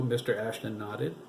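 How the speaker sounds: plain reading voice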